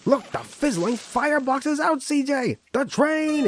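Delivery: high, loud panicked yell. Acted. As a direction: scream the warning at the driver. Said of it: "he yelled".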